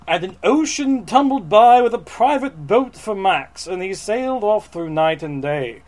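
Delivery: dramatic picture-book narration; storybook read-aloud. Acted. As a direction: read it grandly to an audience. Reads the book text aloud.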